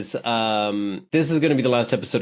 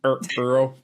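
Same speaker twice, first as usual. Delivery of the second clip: teasing imitation; faster than usual. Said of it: "Stop it".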